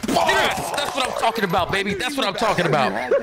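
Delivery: cheering through laughter; high laughing cheer; excited laughing. Real, not acted. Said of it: "I'm laughing".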